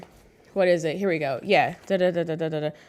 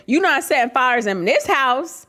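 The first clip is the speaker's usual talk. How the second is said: higher, louder scolding mother; stern mom; 5 semitones higher, 4 dB louder than usual. Retold or imitated. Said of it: "V O of my mom".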